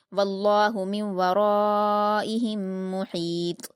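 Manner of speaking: slow, melodic Quran recitation; tajweed recitation. Shown as a demonstration. Teacher reciting the verse for the student. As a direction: chant the verse with elongation.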